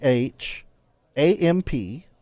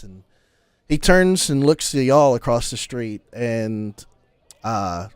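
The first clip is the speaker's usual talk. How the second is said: ordinary delivery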